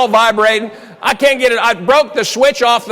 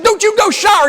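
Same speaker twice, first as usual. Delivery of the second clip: very high-pitched mock scolding; retold scolding; 14 semitones higher, 4 dB louder than usual. Wife stops husband heading to the shower, high mock scolding. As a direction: squawk the order as an alarmed wife.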